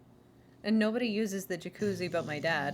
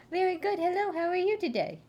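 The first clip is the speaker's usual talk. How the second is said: higher, sweet greeting; 9 semitones higher than usual. Real, not acted. Owner greets the dog, higher.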